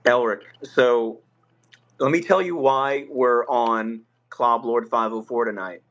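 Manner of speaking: ordinary delivery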